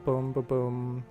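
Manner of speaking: usual speaking voice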